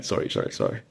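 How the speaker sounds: low, soft repeated apology; sheepish apology. Retold or imitated. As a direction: mumble it, embarrassed. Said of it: "And I go".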